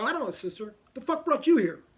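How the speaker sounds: loud, high-pitched shout; rude outburst. Retold or imitated. Patient out of it snaps at a nun, rude loud outburst. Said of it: "I would never, ever speak like this".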